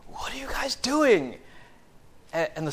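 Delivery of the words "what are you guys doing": mock exasperation. Exasperated Paul voiced.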